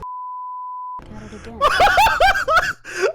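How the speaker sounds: high shrieking laugh; cackling laugh bursting out. Real, not acted. Bleep reaction, loud laugh.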